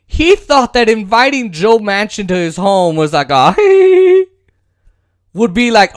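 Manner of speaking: ordinary talk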